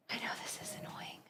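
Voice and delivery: whispered aside; quick hushed whisper. Real, not acted. Aside while filming takes.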